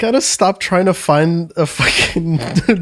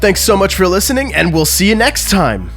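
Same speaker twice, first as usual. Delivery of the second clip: upbeat podcast outro; outro read; 2 semitones lower, slower than usual. Acted. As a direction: read the credits brightly. Patron credits outro read.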